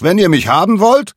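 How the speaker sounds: high, loud mocking taunt; cheeky challenge yelled into the wind; taunting shout. A narrator's reading. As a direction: dare them to catch you. Nolan taunts the falcons.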